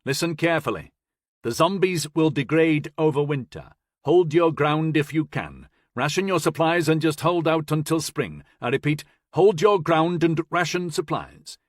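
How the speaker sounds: radio command. Acted. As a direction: give orders over the radio.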